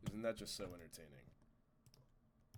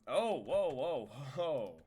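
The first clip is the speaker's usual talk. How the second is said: surprised; 3 semitones higher, 7 dB louder, slower than usual. Real, not acted. Falling by accident.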